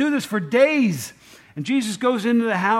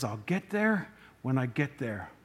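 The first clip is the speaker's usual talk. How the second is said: unhurried Jesus; 5 semitones lower, 7 dB quieter than usual. Acted. Jesus quoted, calm and unhurried.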